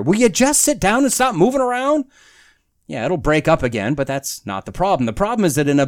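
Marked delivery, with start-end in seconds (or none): 0.0-2.0 put-on high-pitched parent scolding restless kids; exasperated parent. Fed-up parent telling someone to sit down and stop moving, exasperated. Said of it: "I feel like a parent".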